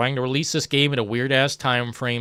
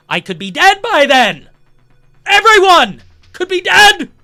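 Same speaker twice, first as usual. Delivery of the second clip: much higher, louder despairing yell; exasperated shouting; 8 dB louder than usual. Real, not acted. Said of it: "This is fucking stupid".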